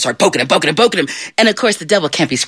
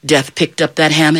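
plain reading voice

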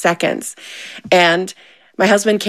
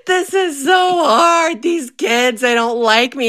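higher, slow, laughing through mock tears; laugh-crying; 7 semitones higher, slower than usual. Mom telling friends her breakdown, laughing through it. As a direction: wail it while cracking up. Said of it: "I laughed so hard and I'm like".

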